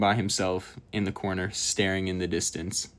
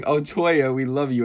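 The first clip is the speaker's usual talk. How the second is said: mild mock scream, pitched up; imitated fangirl scream; 4 semitones higher, 4 dB louder than usual. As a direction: imitate adoring girls calling out, not full volume. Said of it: "the girls are screaming".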